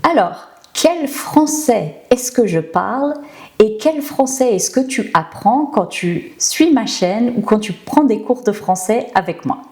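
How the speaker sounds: ordinary delivery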